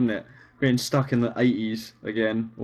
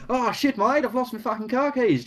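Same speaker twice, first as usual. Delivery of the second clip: very high, hammed-up Aussie accent; mock Australian accent; 11 semitones higher than usual. Shown as a demonstration. Showing harmless banter, mock Australian accent. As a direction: put on broad Australian, pitched right up. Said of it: "when you do voices of Australian people".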